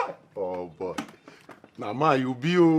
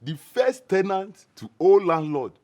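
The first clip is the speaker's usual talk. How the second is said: ordinary talk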